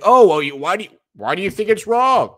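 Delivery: high, loud mock whine; mock the believer's whiny question; mocking opponent. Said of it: "And you're like".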